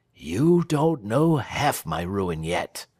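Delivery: ordinary delivery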